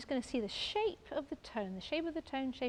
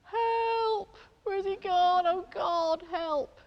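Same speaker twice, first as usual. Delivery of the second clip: hammed-up cry for help, much higher and louder; comic fake alarm over the painting; mock panic; 5 semitones higher, 9 dB louder, much slower than usual. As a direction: play at being terrified, yelling for help. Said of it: "This is the scary bit now".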